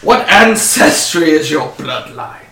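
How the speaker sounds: slow, measured dwarf interrogation; lightly voiced dwarf captain; dwarf interrogator. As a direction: question the suspect slowly and firmly. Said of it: "And the dwarf says".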